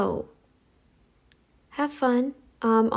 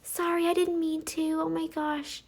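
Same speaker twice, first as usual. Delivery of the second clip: mock apologetic; 6 semitones higher, 7 dB quieter than usual. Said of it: "They're those kinds of people".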